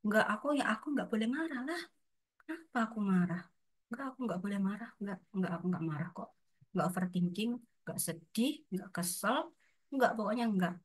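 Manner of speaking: a soft private voice repeating no, no, no; quiet inner denial. Retold or imitated. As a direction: mutter the denial to yourself, trying to convince yourself. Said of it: "malah mikir kalau".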